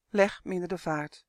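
usual speaking voice

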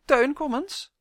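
high, loud summons to her son; calling out. A narrator's reading. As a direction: call him in, loud. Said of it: "riep ze plotseling".

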